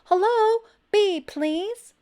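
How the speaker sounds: high, loud call over the wall. A narrator's reading. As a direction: call across to the neighbor. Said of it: "he called".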